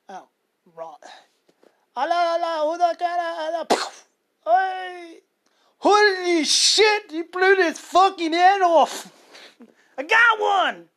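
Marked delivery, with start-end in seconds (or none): 2.0-3.9 high, loud gunfire gibberish; mock battle gibberish. Mimicking battle chatter and gunfire. 4.4-10.9 high, loud whooping soldier; excited shouting. Pretend. Pretend soldier celebrating a kill.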